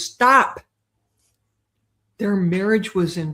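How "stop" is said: higher and louder stop; firmer stop. Retold or imitated. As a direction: cut her off hard. Martha insists, second stop.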